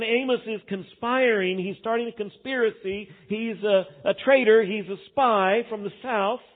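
a priest warning the king, urgently alarmed; alarmed accusation. Retold or imitated. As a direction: sound the alarm about a traitor.

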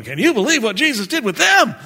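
high, loud, fast exclamation of disbelief; amazed onlookers. Acted. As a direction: exclaim it like a stunned crowd. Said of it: "they're looking at you going".

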